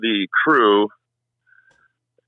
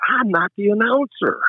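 much higher, pleading mock objection; retold protest in a raised pitch; 11 semitones higher than usual. Racer to track boss who orders him onto the microphone, high-pitched protest.

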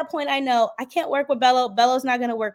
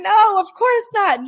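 mock outraged denial; 8 semitones higher, 7 dB louder, slower than usual. Fake reassurance to Kendra.

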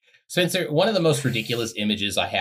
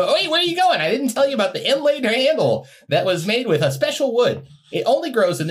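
much higher, louder eager pleading; mock eager salesman; 12 semitones higher, 4 dB louder than usual. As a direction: chase after him, squeaking and eager. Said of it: "he tries to".

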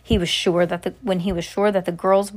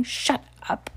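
hissed and soft, higher; spat back; 5 semitones higher, 5 dB quieter than usual. Acted. Winifred hissing at Mary to shut up. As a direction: snap her quiet in a hiss. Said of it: "Winifred spat back".

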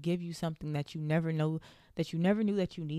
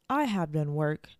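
ordinary talk, nothing marked

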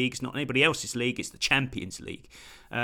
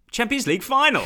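Champions League final called out higher and louder, final hit hard; emphatic demo; 10 semitones higher, 6 dB louder than usual. Shown as a demonstration. Showing how he says it himself, a loud emphatic call. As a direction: proclaim it like the winning call. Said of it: "That's how I say it".

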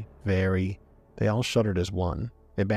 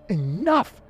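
much higher, louder shout; 12 semitones higher, 4 dB louder than usual. Acted. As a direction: cut them off with a sharp cry. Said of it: "Ibanez's shout echoed".

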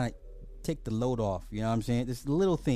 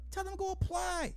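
high sing-song urging. Acted. Repeats the urging line.

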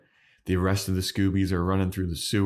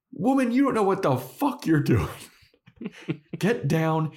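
high, hammed-up furious rant; shrieking scolding, played for laughs; mock angry Giles; 10 semitones higher than usual. Podcaster imitating Giles scolding Anya, high mock fury. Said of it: "Giles is like".

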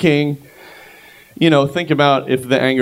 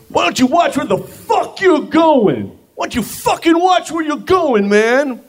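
high mock yell of an angry stranger; imitating the shoving guy, high and furious; 14 semitones higher than usual. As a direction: screech it like the furious guy on the street. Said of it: "and he goes".

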